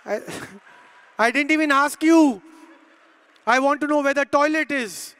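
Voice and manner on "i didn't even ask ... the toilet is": loud, raised mock outrage; retold indignant shout; exasperated retort. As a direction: protest loudly at an unasked answer. Retold exasperated reply to shop assistant.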